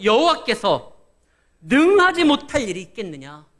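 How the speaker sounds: loud rhetorical challenge from the pulpit; forceful proclamation. Acted. Preacher quoting God's promise to the congregation, loud proclamation. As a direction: proclaim the verse at full, ringing volume.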